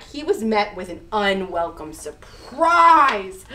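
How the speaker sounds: ordinary delivery, nothing marked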